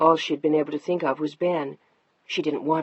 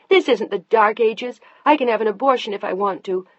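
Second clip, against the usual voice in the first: higher, louder defiant shout; 5 semitones higher, 6 dB louder than usual. Acted. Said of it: "she was shouting at him now".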